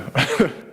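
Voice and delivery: quick laugh rising up; a brief amused laugh; short laugh. Real, not acted. Laughing while recalling his lawyer's panic.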